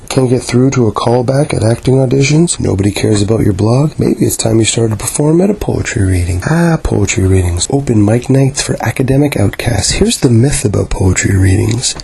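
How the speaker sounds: ordinary delivery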